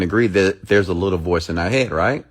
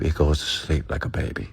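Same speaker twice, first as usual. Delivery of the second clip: softer, whispered, drifting off; whispered lullaby; 5 semitones lower, 7 dB quieter than usual. Shown as a demonstration. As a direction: whisper it like rocking a baby to sleep. Voice told to sleep.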